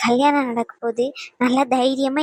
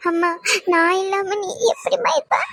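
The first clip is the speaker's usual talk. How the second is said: crying; 5 semitones higher than usual. Acted. Bride parting from mother, weeping.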